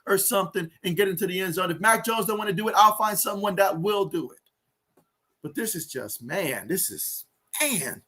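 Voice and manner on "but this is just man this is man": despairing. Real, not acted. Despair over missed opportunities.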